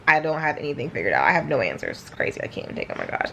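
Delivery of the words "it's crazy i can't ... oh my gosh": breathless whisper, barely voiced; overwhelmed whisper. Real, not acted. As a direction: whisper it, too overwhelmed to speak. Overwhelmed by book plot.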